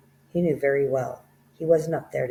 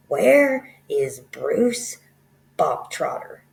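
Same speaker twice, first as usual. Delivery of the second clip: slow, loud, drawn-out demand; stern headmistress demanding; 8 dB louder, much slower than usual. Acted. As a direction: make the whole hall hear it.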